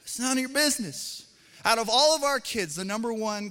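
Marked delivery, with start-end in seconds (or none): 0.0-1.2 flustered dad. Retold or imitated. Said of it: "you're like".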